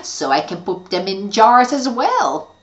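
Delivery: loud, gloating evil witch. Acted.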